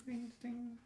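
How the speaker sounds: high, light imitated door chime; high-pitched "ting, ting"; little bell ting. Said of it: "you hear a little ting".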